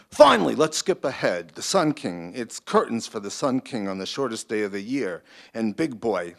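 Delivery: loud, brash Brooklyn producer voice; Brooklyn accent. Acted. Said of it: "I always make it a Brooklyn voice".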